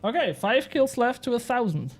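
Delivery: ordinary talk, nothing marked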